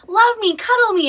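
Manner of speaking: high, loud, clingy plea; needy pleading. Acted. Smothering dog mom begging her aloof dog, needy pleading.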